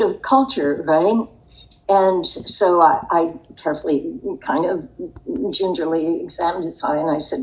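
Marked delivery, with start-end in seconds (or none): none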